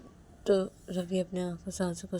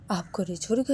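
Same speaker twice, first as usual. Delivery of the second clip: ordinary delivery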